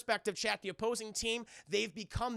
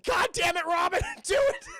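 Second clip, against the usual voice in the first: high, loud exasperated scream; hammed-up furious order; mock angry yell; 11 semitones higher, 9 dB louder than usual. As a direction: yell it till the voice shoots up. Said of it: "I'm sorry I yelled at you".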